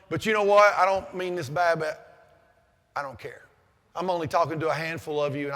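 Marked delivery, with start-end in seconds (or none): 1.8-3.4 quiet blunt aside. Real, not acted.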